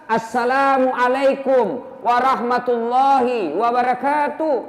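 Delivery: slow, every syllable of Assalamualaikum warahmatullahi wabarakatuh spelled out; full clear salam. Shown as a demonstration. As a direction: lay out each word of the salam carefully. (salam lengkap diucapkan pelan dan jelas)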